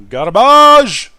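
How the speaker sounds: furious yell at a bad teammate; shouting. Real, not acted. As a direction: bellow it out in disgust. Raging at a teammate.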